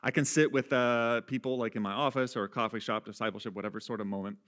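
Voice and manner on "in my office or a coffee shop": ordinary talk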